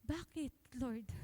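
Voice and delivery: soft, slow, aching plea; grieving plea. Retold or imitated. Pastor recalling his mother's death, softly grieving. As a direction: ask it quietly like a broken son. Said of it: "sobrang sakit ng dibdib ko".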